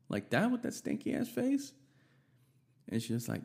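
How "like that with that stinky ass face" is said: squeaky disbelief, much higher; outraged are-you-kidding-me; incredulous. Real, not acted. Narrator mocks the mom's look to listeners, incredulous.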